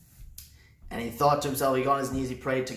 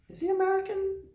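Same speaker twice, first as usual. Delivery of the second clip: very high squeak; mock high-pitched voice; squeaky question; faster than usual. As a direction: pop it up into a squeak.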